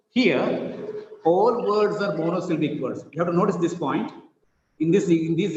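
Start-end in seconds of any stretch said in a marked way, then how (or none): none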